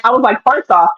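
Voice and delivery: ordinary talk, nothing marked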